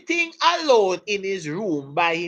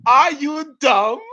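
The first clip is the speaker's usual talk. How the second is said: drawn-out "Are you dumb?" said slowly with a teasing cry; slow mock put-down; 5 semitones higher, 6 dB louder, slower than usual. Teacher showing the class the slang again, slow teasing put-down. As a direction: stretch it out, playfully scornful. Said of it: "Like we say it now".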